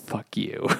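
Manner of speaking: whispered fuck you; muttered curse. Retold or imitated. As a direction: breathe it out under your breath. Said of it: "everyone is just like".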